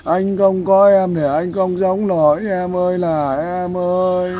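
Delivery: slow, drawn-out imitation of a man crying for his wife; mock wailing plea. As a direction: ham up a heartbroken husband begging, stretching each em ơi. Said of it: "Có nhiều người đàn ông về đây khóc lóc".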